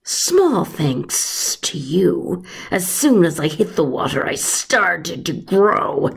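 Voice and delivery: slow, drawn-out serpent voice; monster snake. Acted. The grown snake telling Patrick about the loch, slow creature voice. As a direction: let the huge snake gloat slowly.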